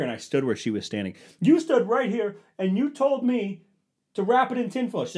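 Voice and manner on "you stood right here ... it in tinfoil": much higher and louder ranting; retold yelling. Husband insisting wife watched him wrap it, loud retold anger. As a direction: lay into her. Retold argument, his line.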